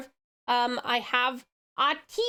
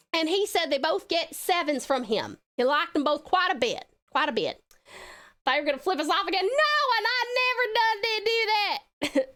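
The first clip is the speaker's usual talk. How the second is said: put-on Southern accent. Streamer telling viewers about the snack ratings, Southern accent. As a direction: play up the Southern twang. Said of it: "Was that southern enough?".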